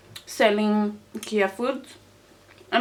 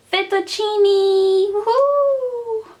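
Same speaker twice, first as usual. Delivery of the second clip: excited; 10 semitones higher, 5 dB louder, much slower than usual. Real, not acted. Excited about favorite food.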